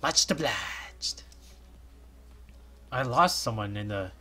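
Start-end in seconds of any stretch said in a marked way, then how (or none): 0.0-1.2 hushed slow thank-you in a whisper. Acted. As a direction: breathe it out slowly. Character reply.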